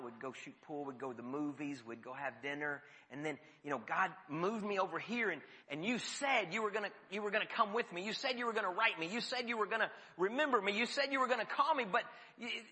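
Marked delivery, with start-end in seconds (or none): 3.9-12.6 hurt plea. Acted. Imagined hurt plea to forgetful friend.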